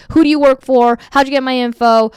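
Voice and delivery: irritated customer firing questions; mock angry caller. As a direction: play the suspicious caller snapping back. Angry lead quoted by trainer.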